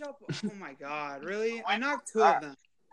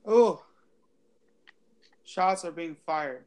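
ordinary delivery

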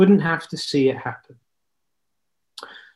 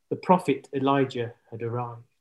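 usual speaking voice

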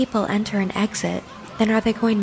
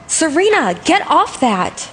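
higher and louder, snapping at her; loud scolding call; 7 semitones higher, 4 dB louder than usual. Acted. Friend yelling at Serena to get off the bear, loud scold.

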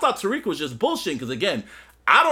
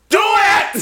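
high, explosive mock yell; full-force hammed-up urging; straining shout; 13 semitones higher, 9 dB louder than usual. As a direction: shout it till the veins pop. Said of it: "Veins popping up".

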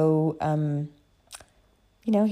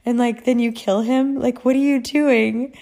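louder, higher exasperated protest; mock indignation; 5 semitones higher, 6 dB louder than usual. Reader to author who killed Louis, mock indignant. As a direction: scold the author in disbelief.

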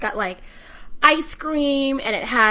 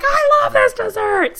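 high, gushing delight; excited mom; 13 semitones higher, 6 dB louder than usual. Retold or imitated. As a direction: gush like a thrilled child. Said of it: "She's like".

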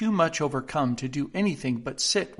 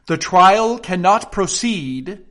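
loud, slow, solemn pronouncement; very grave; 5 semitones higher, 10 dB louder, slower than usual. A narrator's reading. King halting the trial, very grave. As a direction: declare it with heavy authority. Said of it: "in a very grave voice".

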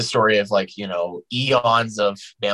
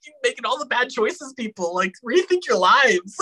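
mock scolding. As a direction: lecture the characters in disbelief. Scolding TV characters, laughing.

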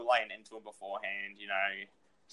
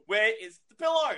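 higher, louder whiny demand; 10 semitones higher, 5 dB louder than usual. Retold or imitated. Friend agreeing on the mate's voice, whiny imitation. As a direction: mimic the upset mate's whine. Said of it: "that's how it was".

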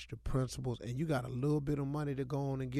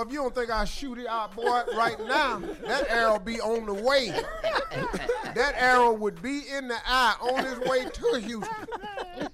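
playful threat through laughter; laughing; 9 semitones higher, 5 dB louder than usual. Real, not acted. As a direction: joke about shooting, laughing.

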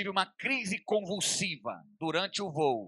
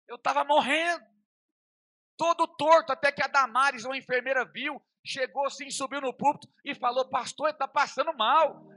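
high, loud, breathless-sounding storytelling; urgent retelling; 9 semitones higher, 7 dB louder than usual. Retold or imitated. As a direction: raise the alarm while recounting it. Conta o sufoco no púlpito, alarmado. (relato aflito, agudo e alto)

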